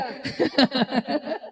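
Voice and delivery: rippling chuckle; amused staccato laughing; teasing laugh. Real, not acted. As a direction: break into a run of laughter.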